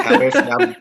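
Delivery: high, loud words through laughter; laughing teasing. Real, not acted. Friend teasing singer about an album, laughing hard. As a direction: tease the singer while cracking up. Joking praise after the song.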